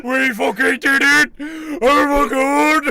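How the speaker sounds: loud, high victory shout; triumphant yell. Real, not acted. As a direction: scream it out in triumph. Celebrating the victory.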